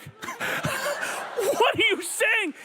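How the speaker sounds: high, slow, drawn-out disbelief through laughter; imitating his wife laughing at his affair joke in the Uber; laughing protest. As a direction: imitate her laughing in mock horror. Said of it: "Sunday's like".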